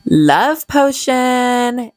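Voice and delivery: slow, drawn-out announcement; dramatic reveal. Real, not acted. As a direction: stretch it out like a big reveal.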